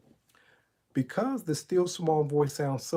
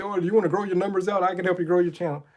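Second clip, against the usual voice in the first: pushy promoter; 4 semitones higher, 4 dB louder, much faster than usual. Retold or imitated.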